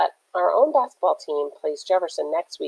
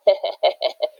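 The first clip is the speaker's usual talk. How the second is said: breathy jeering laugh; mocking snicker; 2 semitones higher than usual. A narrator's reading. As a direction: snicker at the victim. Rivals laugh after the hit.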